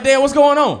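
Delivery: loud, high call; calling to dad. Retold or imitated. Son calling out loudly to his dad. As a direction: shout to get dad's attention. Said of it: "I'm like".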